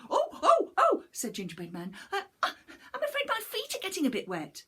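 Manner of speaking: high, squeaky, fast panicked little voice; a tiny cookie in a flap; high panicky little gingerbread man; frightened gingerbread man. Acted.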